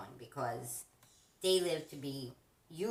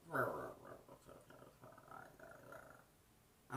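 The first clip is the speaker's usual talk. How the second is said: low mumbling parrot gibberish; bird mumble; 8 semitones lower, 8 dB quieter than usual. Retold or imitated.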